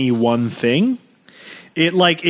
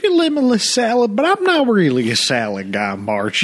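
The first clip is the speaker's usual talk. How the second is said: mock Midwestern dad; 6 semitones higher than usual. Dad voice to Marge.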